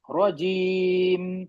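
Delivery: رجیم held very long, lips closed at the end; very slow model reading of رجیم; drawn-out recitation demo. Shown as a demonstration. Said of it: "ہونٹوں کو بند رکھ کر چھوڑ دینا بس".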